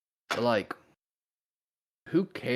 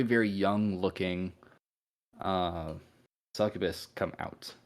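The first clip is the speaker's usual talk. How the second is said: ordinary delivery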